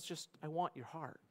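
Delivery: quiet, gentle appeal; soft, sincere answer. Acted. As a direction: answer the cry softly and earnestly. God's quiet answer acted out.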